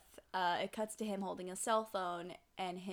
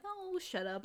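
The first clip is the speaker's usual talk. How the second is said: high-pitched embarrassed protest; mock-flustered, squirming brush-off; bashful; 9 semitones higher than usual. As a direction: imitate a blushing younger brother. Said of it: "And he's blushing".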